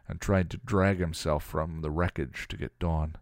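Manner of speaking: usual speaking voice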